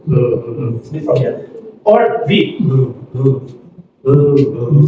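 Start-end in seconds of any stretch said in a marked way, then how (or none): 2.6-4.9 low, buzzing "mm"; voiced throat sound. Shown as a demonstration. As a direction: vibrate the throat on each "mm". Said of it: "so be from here".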